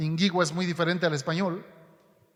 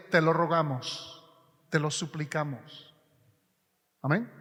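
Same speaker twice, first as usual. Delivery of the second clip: softer and softer, a quiet begging prayer; soft supplication; 5 semitones lower, 5 dB quieter than usual. Shown as a demonstration. Preacher voicing the people's plea to the Lord, softly begging. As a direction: let it fade into a humble plea. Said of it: "Es una oración de súplica".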